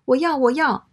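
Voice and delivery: put-on high-pitched little girl's plea; eager little girl. A narrator's reading. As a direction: speak up high like an eager child. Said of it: "小女孩听明白了". (小女孩抢着要的高音)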